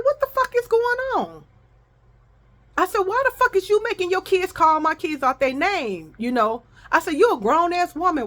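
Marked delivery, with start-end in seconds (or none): 0.0-1.4 high-pitched indignant demand; re-enacted outraged challenge; retold angry confrontation. 3.0-6.1 high, indignant challenge; heated mock-argument demand; retold angry confrontation. 7.1-8.3 retold scolding.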